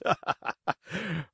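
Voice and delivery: shaky brave laugh; nervous forced chuckle. A narrator's reading. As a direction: fake a laugh to hide fear. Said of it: "trying bravely to laugh".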